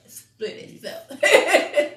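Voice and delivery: high slow laughing repeat. Real, not acted. To her co-host about the glitch, a slow high laughing repeat.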